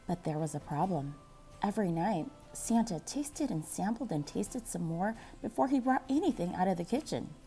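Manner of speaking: plain reading voice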